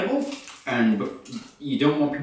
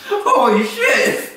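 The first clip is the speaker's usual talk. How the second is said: laughing; 6 dB louder, much slower than usual. Real, not acted. Laughing at pentagram toy.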